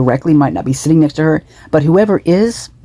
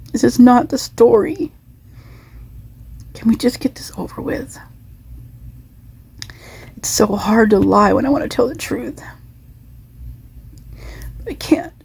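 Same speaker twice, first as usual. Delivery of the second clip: much higher, pleading, fading to whispers; voicing her thoughts; 8 semitones higher, 3 dB quieter than usual. Vlogger voicing the woman's hidden thoughts, high and pleading. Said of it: "She's like".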